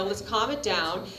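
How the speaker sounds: ordinary talk, nothing marked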